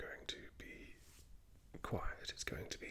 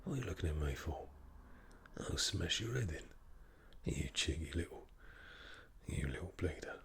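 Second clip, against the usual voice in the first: threatening Cockney hard man; Jason Statham. Acted. Cockney tough threatening someone for looking at him. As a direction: pick a fight in a Cockney accent. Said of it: "Jason Statham".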